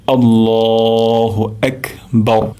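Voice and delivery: আল্লাহু আকবার drawn out very slowly; a slow, careful recitation demo. Shown as a demonstration. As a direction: chant আল্লাহু আকবার with every sound lengthened. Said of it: "আরেকবার উচ্চারণ করছি".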